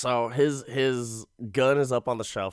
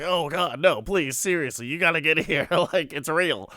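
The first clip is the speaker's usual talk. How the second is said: high, pleading panic; retold frantic phone plea; mock freak-out; 6 semitones higher, 4 dB louder than usual. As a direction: plead urgently, half laughing. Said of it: "she starts flipping out".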